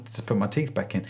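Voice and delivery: normal talking voice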